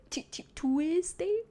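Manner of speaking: slow, high "TOO-wiz-day", stumbling on the T; trying unfamiliar pronunciation. Shown as a demonstration. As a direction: sound out "Tuesday" with a hard T, doubtfully. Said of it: "Do people say Tuesday?".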